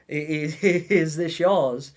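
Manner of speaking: nervous laugh. Retold or imitated. Facing the mother kangaroo.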